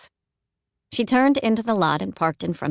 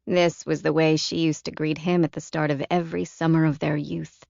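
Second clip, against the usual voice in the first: usual speaking voice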